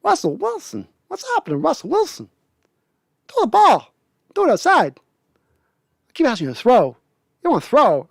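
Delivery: pleading Walken with a squeaky high voice; Walken impression. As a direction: badger the quarterback in a Walken cadence. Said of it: "Walken's just, it's lacking".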